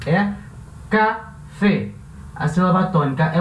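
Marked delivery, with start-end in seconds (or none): 0.9-1.9 café split as ca-fé, stress on fé; syllables split. Shown as a demonstration. Teacher showing students the stressed syllable, stress marked. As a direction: lean into the last syllable of café. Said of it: "se a gente separar as sílabas".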